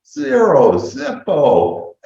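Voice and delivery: triumphant reveal. Real, not acted. Telling the audience what the dentist cost, triumphant and loud. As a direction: announce it like a prize.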